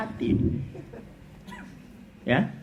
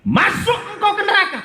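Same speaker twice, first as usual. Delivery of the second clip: booming, much higher and louder verdict; divine command; 13 semitones higher, 7 dB louder than usual. Retold or imitated. God commanding the sinner to hell, booming and high. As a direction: thunder the sentence down from above. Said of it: "Allah langsung berfirman".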